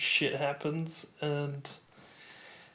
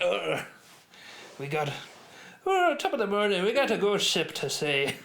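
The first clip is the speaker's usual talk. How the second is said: hammed-up stage Irish, pitched up; mock top-of-the-morning Irish lilt; Irish accent impression; 4 semitones higher than usual. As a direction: put on a cheery exaggerated Irish brogue. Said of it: "That's what he sounded like".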